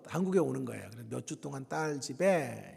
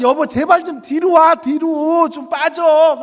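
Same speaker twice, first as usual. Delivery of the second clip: exasperated shout; 10 semitones higher, 16 dB louder, slower than usual. Retold or imitated. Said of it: "나랑 딸은".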